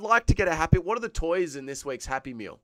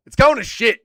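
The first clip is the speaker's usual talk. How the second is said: loud and much higher; exasperated yell; ranting shout; 9 semitones higher, 15 dB louder than usual. Real, not acted. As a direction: blast it out in outrage. Ranting about Happy Meal.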